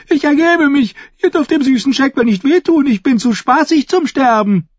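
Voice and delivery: high, squealing jester begging; screamed pleading. A narrator's reading. The fool begs not to be hurt, high screaming. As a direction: plead loudly for your life in a fool's squeal. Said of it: "schrie der Narr".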